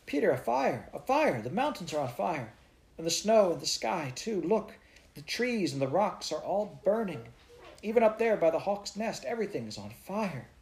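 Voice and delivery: put-on a girl calling to Peter about a fire; excited crying out. A narrator's reading. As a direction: shout it as if the mountains burn.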